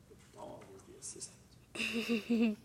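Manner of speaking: soft whispered aside to the listener. Acted. Narrator whispering an aside to the children. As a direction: let them in on a secret, in a whisper.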